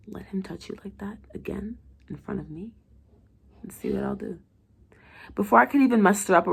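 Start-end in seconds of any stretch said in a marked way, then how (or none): 0.1-4.4 hushed jealous threat; menacing whisper. A narrator's reading. Patient threatening the doctor, jealous whisper. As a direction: threaten her softly through glass. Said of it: "gets closer to it and whispers".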